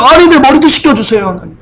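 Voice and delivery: high, urgent imitation of a startled disciple; acted-out alarmed begging; startled plea. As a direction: plead as if about to be disowned. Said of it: "놀라서".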